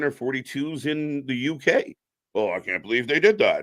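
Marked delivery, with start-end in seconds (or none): 2.3-3.6 lightly mocking fan voice, a bit lower; a hint of a put-on grumbling fan; complaining fan. Imitating fans upset about WrestleMania in the UK, mock complaint.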